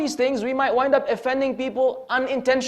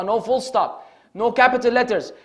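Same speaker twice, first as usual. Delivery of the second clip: ordinary talk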